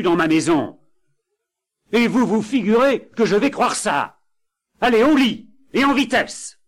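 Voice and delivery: high, loud furious ghost, rising to a yell; angry ghost. Acted. Ghost to the couple, furious and ordering them to bed. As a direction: rage at them and drive them off to bed. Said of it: "le fantôme se mit en colère".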